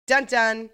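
punchy mock dun dun sting; Law and Order dun dun. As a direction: hit it like the show's gavel sound. Show's signature sound effect.

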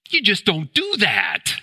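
high, indignant disbelief; aghast protest. Acted. Preacher voicing the crowd at the desecrated tomb, aghast. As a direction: protest it as a scandalised onlooker.

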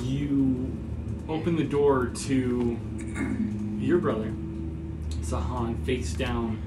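slow, low, soft narration with long pauses; hushed, deliberate tabletop narration; game master scene-setting. Acted. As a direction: set a grim scene, quietly and unhurriedly.

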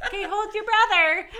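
parent instructing. Retold or imitated. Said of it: "And they're like".

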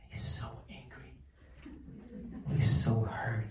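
whispered, hurt and bitter; hushed whisper. A narrator's reading. Rabbi tells of the cheated man, whispered.

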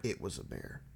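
soft breathy whispered line. Retold or imitated. Friend echoes the ranger's cover-up line, whispering.

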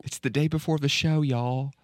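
high-pitched acted film quote; playful quote in a lifted voice; quoted movie line. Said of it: "Name that movie".

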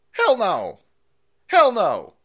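loud, high hell no; emphatic loud refusal. Real, not acted. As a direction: bark it out. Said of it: "emphatic refusal".

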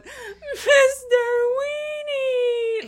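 very high, drawn-out wail; mock bawling. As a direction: wail it out like a hammed-up sobbing mom. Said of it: "she's crying in a towel going".

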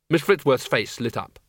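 ordinary delivery, nothing marked

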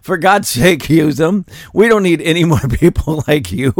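ordinary talk

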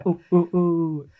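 slow imitated monkey hoots; drawn-out "ooh" imitation; ape hooting. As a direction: hoot slowly like apes joining the song. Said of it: "they start going".

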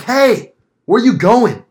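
loud, high holler; re-enacted yell at a passerby; retold yelling. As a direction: call out to someone down the street. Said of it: "I'm yelling at this tweaker".

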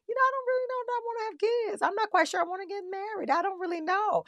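quick, high-pitched mock confession; hesitant women's voice. Women admitting once-taboo doubts about marriage, in high hesitant voice.

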